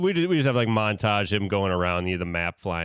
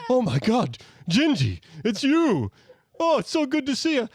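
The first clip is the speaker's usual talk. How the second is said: excited reunion. Acted. Santa greeting Gingy.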